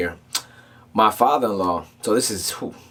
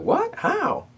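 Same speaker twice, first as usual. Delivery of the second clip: high-pitched, hushed "what"; shocked disbelief. Retold or imitated. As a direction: squeak it out in retold disbelief. Reacting to news of theft.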